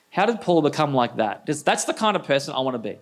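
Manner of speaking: ordinary talk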